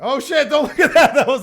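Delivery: sudden high panicked yelp; startled shout. Real, not acted. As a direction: yelp in laughing alarm. Accidentally shows wrong screen.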